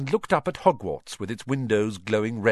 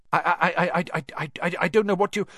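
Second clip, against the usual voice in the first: high, stammering, frightened Quirrell; nervous stutter; 8 semitones higher, 4 dB louder, faster than usual. A narrator's reading. Quirrell stammering to Snape, high and nervous. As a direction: stutter through every word, much higher. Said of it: "he was stuttering worse than ever".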